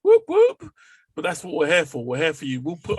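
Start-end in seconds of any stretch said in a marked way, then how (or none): 0.0-0.7 high loud double whoop; excited whoop. Real, not acted. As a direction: whoop like a fan. Celebrating on podcast.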